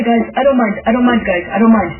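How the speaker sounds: ordinary talk, nothing marked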